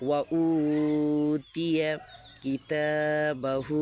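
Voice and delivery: slow chanted recitation, vowels drawn long; Quran recitation. Acted. Teacher chanting the verse for the class. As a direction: recite it melodically and slowly.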